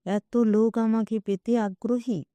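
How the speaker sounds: ordinary delivery, nothing marked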